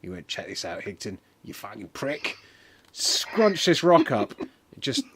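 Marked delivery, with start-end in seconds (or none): 0.3-2.4 lightly voiced tough guy, low and hushed; lower, much softer taunt; wrestler's taunt. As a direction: drop it low and quiet. Voicing the wrestler's boast.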